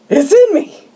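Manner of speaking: high-pitched mock horror; freaking out. Harry finding the bug inside him, hammed-up horror. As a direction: imitate a man horrified and panicking. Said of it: "he's freaking out".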